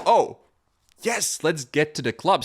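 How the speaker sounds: high, loud thrilled cheer; triumphant. Retold or imitated. After landing the jump, cheering to head to the club. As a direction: crow with victory, ready to party.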